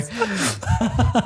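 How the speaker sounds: podcast host laughing out the show name. Real, not acted. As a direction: stretch the word out through a laugh.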